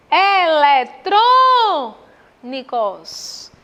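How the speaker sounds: "Eletrônicos" very slow and loud, punching "trô"; stressed syllable drawn out. Shown as a demonstration. Teacher showing students the antepenultimate stress, very slow. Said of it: "Antepenúltima sílaba mais forte".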